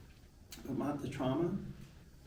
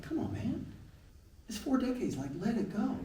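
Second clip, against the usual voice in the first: higher, brushing-off voice of a friend; mock dismissive friend; 9 semitones higher, 3 dB quieter than usual. Friend telling him to get over it, mock dismissive. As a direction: wave it away like it's nothing. Others dismissing his old trauma.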